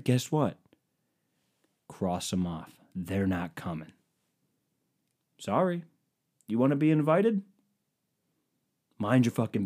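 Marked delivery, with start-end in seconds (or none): none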